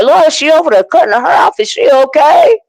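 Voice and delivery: high, incredulous questioning; mock-worried disbelief; alarmed reaction. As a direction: retell your alarm, pitched well up. Retells alarmed reaction to Kwani.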